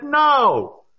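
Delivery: a sharp, high, loud "No"; curt no. Retold or imitated. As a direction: snap the refusal back at your mother, short and loud. Snapping no at mom's offer.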